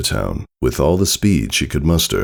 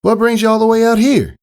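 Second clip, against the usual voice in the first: high, startled country mare voice; a jolted farm pony catching her breath; 5 dB louder than usual. Acted. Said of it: "Applejack said".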